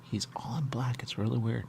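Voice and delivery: unvoiced whispered aside; hushed whisper to the viewers. Real, not acted. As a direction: whisper it so nobody hears. Whispering aside while playing.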